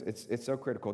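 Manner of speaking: usual speaking voice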